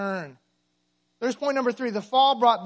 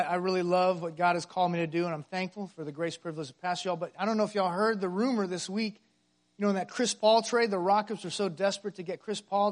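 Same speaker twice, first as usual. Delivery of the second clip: usual speaking voice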